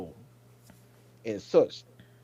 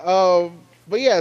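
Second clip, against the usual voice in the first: ordinary talk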